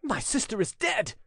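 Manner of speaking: high-pitched cry of shock; anguished cry. A narrator's reading. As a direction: cry out the terrible news, pitch leaping up. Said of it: "cried Gabriel".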